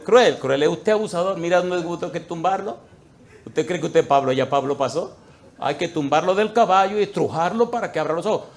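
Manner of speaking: loud, high rebuke; stern rebuke. Retold or imitated. Pastor rebukes the abusive husband, loud and stern. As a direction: knock him off his horse with your voice.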